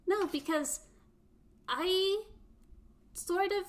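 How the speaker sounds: high, exasperated robot protest. Acted. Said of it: "exasperatedly going".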